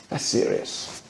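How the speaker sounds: hushed, voiceless awe; whispered in shocked awe; awed whisper. Retold or imitated. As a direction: breathe it out stunned. Awed reaction retold.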